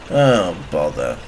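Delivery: very low, soft, slow grumble; deep, deflated mumble; dejected sigh. A narrator's reading. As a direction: sigh it to yourself, sinking low. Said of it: "sighed Bowler to himself".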